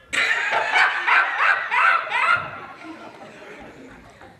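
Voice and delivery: high, loud peal of laughter; princess bursts out laughing. Acted. Princess laughing for the first time, high bright peal. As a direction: let a high giggle burst out after a lifetime without laughing. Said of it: "she went".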